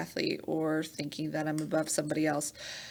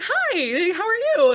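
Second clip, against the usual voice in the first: much higher and louder greeting; cheery high hello; 13 semitones higher, 9 dB louder than usual. Retold or imitated. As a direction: bounce in with a bright hi. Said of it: "I'll just be like".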